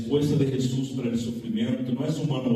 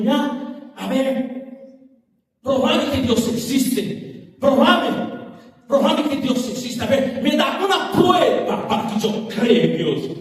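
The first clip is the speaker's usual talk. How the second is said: mock challenge; 9 semitones higher, 5 dB louder than usual. Atheist challenging the pastor.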